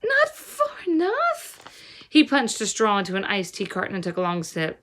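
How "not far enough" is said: very high, slow, crying disbelief; girl crying out at the plan; distressed disbelief. A narrator's reading. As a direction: wail it out in dismay.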